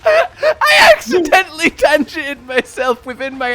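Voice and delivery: high, slow, loud through laughter; helpless laughter. Real, not acted. Explaining his slip of the tongue, laughing hard. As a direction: squeak it out while cracking up.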